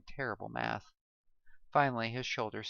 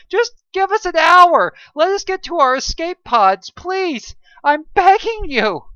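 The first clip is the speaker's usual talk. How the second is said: high, loud desperate pleading scream; frantic begging; 20 dB louder than usual. A narrator's reading. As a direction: beg the captain for their lives. Desperate plea for 248 lives.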